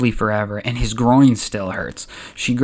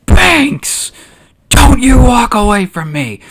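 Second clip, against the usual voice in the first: high, loud yell after someone leaving; furious scream; 12 semitones higher, 8 dB louder, slower than usual. Acted. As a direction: scream it across the room in outrage. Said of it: "walking out of the room and screams".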